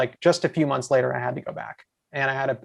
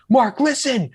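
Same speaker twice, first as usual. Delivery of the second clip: high-pitched retold excited phone call; overjoyed; 9 semitones higher, 3 dB louder, slower than usual. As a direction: imitate an overwhelmed call to a friend. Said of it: "real happy tears crying, genuinely going like".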